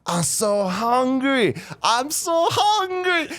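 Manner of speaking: high, drawn-out goofy voice; wobbly high stoner whine; stoned guy's line. Retold or imitated. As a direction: stretch it out like a giggly stoned kid. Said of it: "this motherfucker goes".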